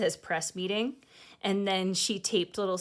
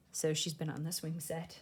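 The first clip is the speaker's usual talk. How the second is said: usual speaking voice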